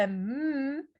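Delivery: M as a long closed-lip hum; nasal sound demo. Shown as a demonstration. Teacher showing sounds that differ, nasal hum. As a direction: hum the M and hold it. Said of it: "a more nasal sound".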